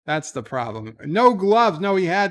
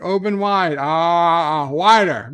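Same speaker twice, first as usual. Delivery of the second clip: louder, imitating a pushy dentist; hammed-up dentist demanding wider; mock dentist; 7 dB louder, slower than usual. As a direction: bark it like an impatient dentist.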